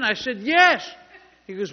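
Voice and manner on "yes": loud, high, exasperated retort; angry yell. Retold or imitated. Man in a cast answering a repeated question, angry yell. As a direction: shout back the answer, acting out a yelling match. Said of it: "I was getting mad".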